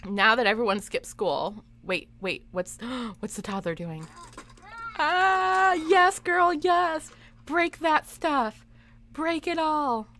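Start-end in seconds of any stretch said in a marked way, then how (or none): 5.0-10.1 gleeful mock cheer. Cheering the toddler's destruction.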